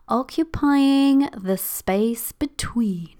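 slow, breathy whisper like a mysterious title; slow spooky whisper of "occupying the space between"; whispered for effect. Shown as a demonstration. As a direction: hush it as a dramatic sound effect. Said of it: "I feel like we need some sort of sound effect there".

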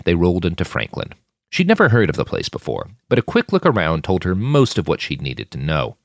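plain reading voice

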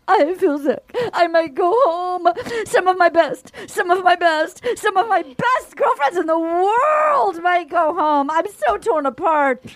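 hammed-up weepy wail, building on the repeats; mock meltdown, stretching "world" out high; imitated crying. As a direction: gasp and wail through it like a sobbing castmate. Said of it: "She starts crying".

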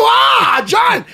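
high, loud retold shout; shouted protest. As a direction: yell it back at him in mock outrage. Retorting loudly to John.

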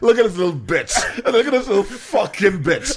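high, laughing taunt that ends in a shout; laughing mock rant. Friend mocks the guy ignoring his link, laughing and shouting. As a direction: cackle through the insult, then yell it.

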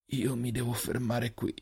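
soft, slow whisper of a dying man; failing whisper. A narrator's reading. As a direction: whisper it slowly as strength fades. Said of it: "la voce ridotta a un sussurro".

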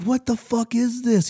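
high, slow, incredulous sergeant voice; mock drill sergeant. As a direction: play the sergeant who can't believe what he's holding. Drill sergeant holding up loofah.

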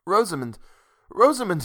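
high, anguished calling of her name; grieving lover's cry; sorrowful cry. A narrator's reading. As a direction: cry out in sorrow, pitch leaping up. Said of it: "he cried sorrowfully".

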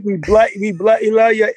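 high, playful fake English accent; mock English accent. Guest teasing the host in a mock English accent. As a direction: ham up a cheeky English accent. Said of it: "Any English accents we can get going".

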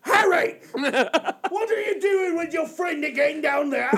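high shrill mom yelling through laughter. Acted. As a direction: shout down like an angry mom. Mom yelling at Harry in skit.

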